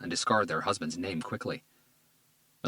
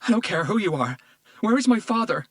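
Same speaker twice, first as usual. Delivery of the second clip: much higher and louder, frantic; desperate shout; 14 semitones higher, 8 dB louder than usual. Acted. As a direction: shout it, pushing past him. Desperate daughter shouting at detective.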